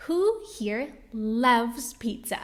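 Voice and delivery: ordinary talk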